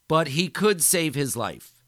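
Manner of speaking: loud, high triumphant proclamation; bellowing. Acted. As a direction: proclaim it over the crowd. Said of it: "Micheletti bellowed".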